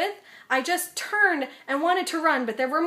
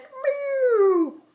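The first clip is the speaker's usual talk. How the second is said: mock meow; 8 semitones higher, 4 dB louder than usual. Mind blanks, mock meow.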